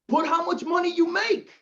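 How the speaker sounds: high-pitched app instruction. Acted.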